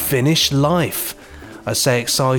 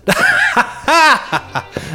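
much higher theatrical laugh, imitated; massive laugh; 13 semitones higher than usual. Imitating the character's laugh after his line, massive and hammed-up. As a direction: burst into a hammed-up villainous-sized laugh. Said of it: "he gives this massive laugh".

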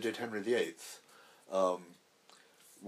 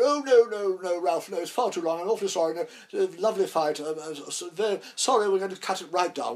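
much higher and louder, fluting, apologetic; Gielgud impression; 8 semitones higher, 9 dB louder than usual. Gielgud cutting Ralph's long fight, high, gushing apology. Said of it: "Gielgud said".

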